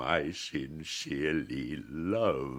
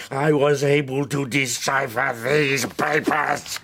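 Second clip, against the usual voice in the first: louder, higher, pained questioning; anguished outcry; 6 semitones higher, 8 dB louder than usual. Acted. The creature cries out, asking what he is, in loud anguish. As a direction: cry it out in torment.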